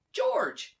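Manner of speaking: high, loud call across the room; shouted name. Acted. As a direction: call out to him from the doorway. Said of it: "somebody shouted".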